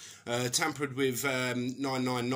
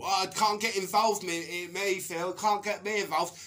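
higher, lightly whiny friend dodging blame; mock whiny excuse; 6 semitones higher than usual. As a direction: whine it like a coward ducking out.

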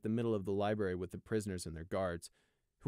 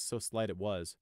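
ordinary delivery, nothing marked

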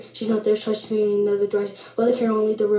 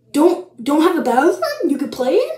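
raised, swooping questioning read-back; incredulous; 4 semitones higher, 3 dB louder than usual. Real, not acted. Vlogger reading back a silly rule, incredulous. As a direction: echo it in disbelief, rising at the end. Repeats the instruction in disbelief.

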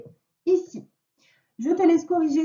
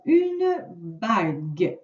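slow dictation; 4 semitones lower, much slower than usual. Shown as a demonstration. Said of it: "Le premier mot".

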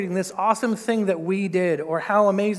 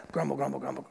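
low, muttered grumble fading away; mock grumbling; 6 semitones lower, 10 dB quieter, faster than usual. Israelites grumbling after God's gifts, low mock muttering. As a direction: grumble under your breath, trailing off.

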